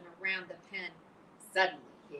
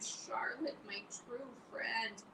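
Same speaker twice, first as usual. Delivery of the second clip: slow, softer grieving whisper; moaning whisper; 6 semitones higher, 5 dB quieter, much slower than usual. Acted. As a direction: breathe it out in grief. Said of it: "he moaned".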